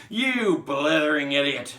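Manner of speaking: slow, high, roaring insult; furious shout. Acted. Trunchbull insulting Wilfred over his sum, furious shout. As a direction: bellow it, stretching the insult out. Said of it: "shouted the Trunchbull".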